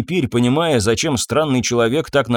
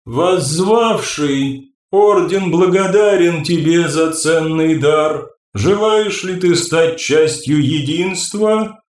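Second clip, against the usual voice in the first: ringing crystal chime voice; 4 semitones higher, slower than usual. Acted. The Sella figure addresses Odysseus.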